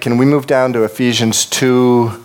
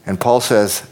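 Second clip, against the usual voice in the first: ordinary talk, nothing marked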